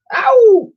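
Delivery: very high, loud ow; pained yelp. Acted. Twin yelping when hit with a toy. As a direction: yelp at the whack.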